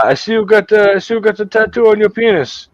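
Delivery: put-on high Jamaican accent; Jamaican guy. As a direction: point it out casually in a Jamaican lilt. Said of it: "this Jamaican guy".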